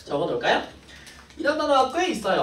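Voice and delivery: usual speaking voice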